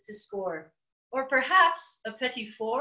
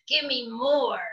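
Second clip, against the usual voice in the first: slow croaky buzzard voice; croaking bird. Acted. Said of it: "the bird croaked".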